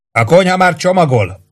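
sustained high-pitched call; narrated shout, pitch held up. A narrator's reading. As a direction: yell a report down from above. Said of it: "ordította le Warden".

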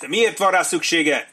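high, loud, slowed query; shouted question. A narrator's reading. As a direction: call the question across. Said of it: "kiáltotta egy hang".

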